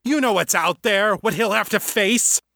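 high-pitched, heated argument; angry, insistent father; snapping. A narrator's reading. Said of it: "Father snapped".

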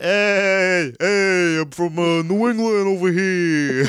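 slow hammed-up New England accent; mock New England accent. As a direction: ham up a New England guy. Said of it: "the new master of accents".

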